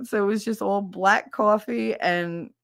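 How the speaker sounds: ordinary talk, nothing marked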